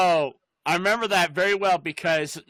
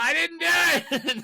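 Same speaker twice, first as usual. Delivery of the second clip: slow, high mock wail; imitated drawn-out protest; 9 semitones higher, slower than usual. Kenny runs from the cop, slow mock wailing. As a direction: stretch out the hammed-up plea of innocence.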